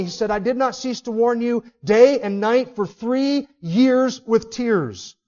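loud, driving pulpit delivery; preaching at full force. Acted. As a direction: hammer each warning home to the congregation.

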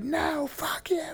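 very high, drawn-out mimicked tantrum; mock screechy refusal. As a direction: squeal it like a furious drunk girlfriend, without volume. Retold screaming girlfriend's refusal.

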